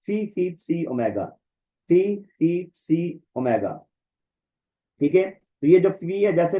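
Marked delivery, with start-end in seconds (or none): 0.1-1.3 phi as "fee", psi as "see"; "ee"-ending pronunciation. Shown as a demonstration. Teacher drills second option, phi and psi with "ee" endings. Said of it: "फी भी बोलते हैं इसको". 1.9-3.8 phi as "fee", psi as "see"; "ee"-ending pronunciation. Shown as a demonstration. Teacher drills second option, phi and psi with "ee" endings. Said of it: "फी भी बोलते हैं इसको".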